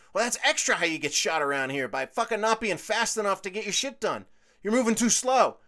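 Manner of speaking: scolding. Retold or imitated. Scolding customers.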